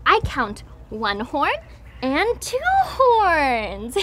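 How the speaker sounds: ordinary delivery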